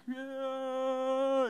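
long, high held holler; dad's imitated yell. Preacher imitating dad warning his son from the dock, long high yell. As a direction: holler out over the water in one sustained cry. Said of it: "and he's yelling".

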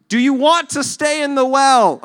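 high, loud, slow challenge; exasperated rescuer. Acted. Rescuers to man in the well, exasperated shout. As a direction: demand it in loud disbelief. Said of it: "There's a moment where they're".

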